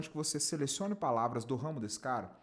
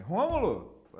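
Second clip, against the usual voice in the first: "Rômulo!" shouted out high and loud; energetic greeting; 6 semitones higher, 8 dB louder, much slower than usual. Shown as a demonstration. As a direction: throw the name up with energy and lift the call. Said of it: "com essa entonação". ("Rômulo!" bem alto e animado, lá pra cima)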